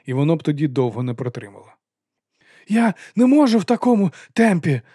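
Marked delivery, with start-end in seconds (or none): 2.7-4.8 high, loud breathless cry. A narrator's reading. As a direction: gasp it out while running. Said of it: "захекано зронив він".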